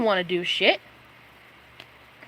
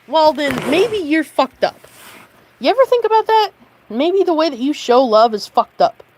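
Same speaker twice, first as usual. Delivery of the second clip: loud and higher, pure anger; furious shout; 4 semitones higher, 8 dB louder than usual. Real, not acted. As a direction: yell it at them. Furious rant at former friend.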